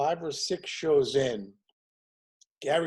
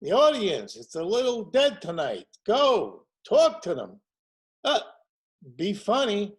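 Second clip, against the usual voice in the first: Gary Marshall; 7 semitones higher, 7 dB louder than usual. Retold or imitated. Producer tells the young writer to warm up the crowd, in a louder, higher voice. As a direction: lean on his cadence, a touch hammed. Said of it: "you know how he spoke, Gary? ... Had that cadence".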